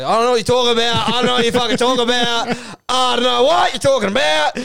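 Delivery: high, loud, building insistence; frantic denial. Acted. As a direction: protest harder with each repeat. Acted denial, growing more frantic.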